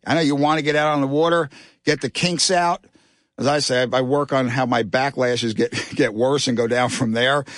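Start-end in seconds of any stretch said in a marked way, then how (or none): none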